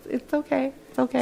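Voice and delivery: quiet, soothing it's okay; soft reassurance. Retold or imitated. Comic hushing overexcited fan in store, softly. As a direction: hush her gently.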